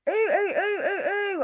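high, squealing ooh of disgust. Real, not acted. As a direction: squeal like you're grossed out. Reacting with disgust to crude talk.

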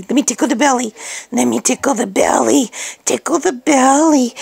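usual speaking voice

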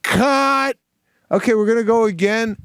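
high called-out cut, then quick announcement; calling cut. Retold or imitated. Assistant director ending the take, calling out. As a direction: shout it across the set like an AD. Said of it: "And I get".

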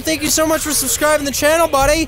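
high-pitched streamer hype; hyped subscriber shout-out. Real, not acted. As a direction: squeal the thanks with excitement. Streamer hypes a new subscriber.

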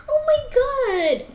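high, drawn-out wail; dismayed outcry. Real, not acted. Player failing to stay on the path, dismayed outcry. Fails again in the game.

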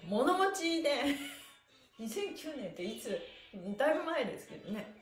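ordinary talk

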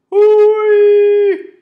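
very high, very loud howl; wolf howl. Acted. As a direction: throw back your head and howl. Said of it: "so he howled".

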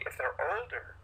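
normal talking voice

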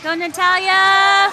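slow, loud, high cheer; cheering shout. Real, not acted. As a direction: drag out the cheer. Parent cheering at girls' game.

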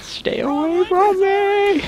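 very high, drawn-out mock wail; mock panic. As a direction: stretch it out in pretend terror. Playful panic during game chase.

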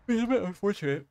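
talking through a yawn; words stretched by a yawn. Real, not acted. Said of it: "Dude, I don't know why I'm yawning".

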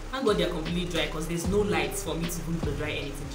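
ordinary talk

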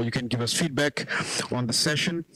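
ordinary talk

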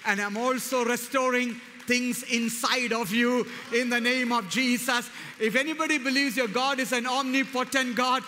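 preacher stirring the church toward praise; preaching at full force. Acted. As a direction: proclaim it over the congregation. Declares God's promise, calls praise.

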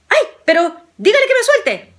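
quejido agudo y más fuerte; súplica asustada. A narrator's reading. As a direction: suplica agudo, con susto. Juan suplica que lo suelte. (Juan mordido suplica al viajero, agudo y asustado)

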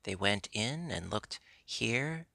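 ordinary delivery

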